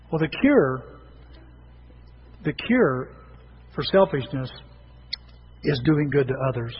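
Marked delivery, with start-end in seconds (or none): none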